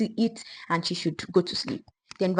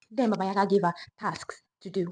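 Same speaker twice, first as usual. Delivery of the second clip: ordinary delivery, nothing marked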